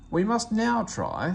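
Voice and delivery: slow, loud stern start; cross. Acted. Fat Controller scolds Percy, slow and cross. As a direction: scold with weight. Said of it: "said the Fat Controller crossly".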